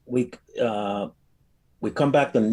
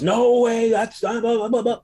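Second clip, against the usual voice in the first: much higher, louder whiny protest; mocking prosecutor; 12 semitones higher, 5 dB louder than usual. Prosecutor objecting to the judge, mocked as a whiny squeal. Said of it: "Prosecutor goes".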